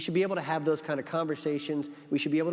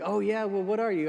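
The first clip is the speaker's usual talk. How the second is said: eager questioner; 8 semitones higher than usual. Retold or imitated. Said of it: "He's like".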